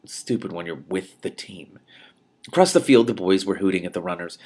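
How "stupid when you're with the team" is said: slow, voiceless trailing whisper; bitter whisper. Acted. Runner muttering to herself about the team, whispered. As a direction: let the self-reproach sink to a whisper. End of self-scolding list.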